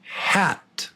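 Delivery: "hat" whispered high with a flat front "a"; breathy test word "hat"; quiz word demo. Shown as a demonstration. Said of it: "Yeah, again".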